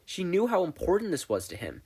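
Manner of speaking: plain reading voice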